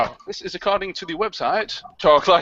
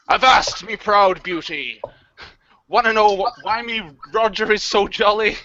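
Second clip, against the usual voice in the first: hammed-up pirate growl; 3 semitones higher than usual. Shown as a demonstration. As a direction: lay on the pirate brogue. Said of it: "top ten pickup lines for use on International Talk Like a Pirate Day".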